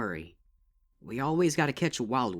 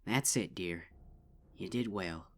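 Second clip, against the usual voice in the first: softer, reassuring big sister; gentle comfort; 4 semitones lower, 8 dB quieter than usual. A narrator's reading. Big sister comforts shaken sister, soft and tender. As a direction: soothe her quietly.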